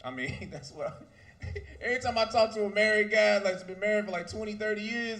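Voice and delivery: usual speaking voice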